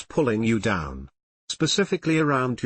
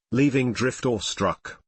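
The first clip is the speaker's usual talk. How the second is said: ordinary delivery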